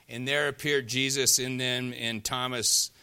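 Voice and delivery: ordinary talk, nothing marked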